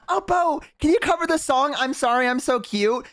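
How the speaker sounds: high-pitched mock fan request; pleading fans. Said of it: "you guys".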